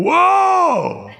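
high shouted mock-startled whoa. Pastor imagining Jesus waking startled, mock shout. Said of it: "imagine Jesus waking up and going".

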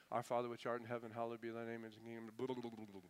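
quick, low-key mumbo-jumbo recital, fading into blahs; rattled-off rote prayer. Retold or imitated. Preacher mocks a wordy prayer Peter didn't say, rattled off. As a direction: race through a mock formal prayer. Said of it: "He didn't say".

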